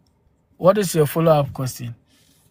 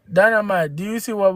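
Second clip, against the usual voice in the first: normal talking voice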